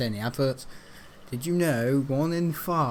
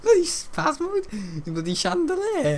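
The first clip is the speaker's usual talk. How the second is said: high squeaky mock indignation; mock outraged squeal; slower than usual. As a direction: shriek it like a put-upon character. High drawn-out put-on voice.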